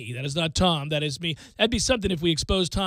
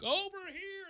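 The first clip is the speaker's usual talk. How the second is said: high, soft, singsong call from off-mic; away from the mic; 10 dB quieter than usual. Shown as a demonstration. Host showing he could roam from the mic, distant high call. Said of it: "I could be like".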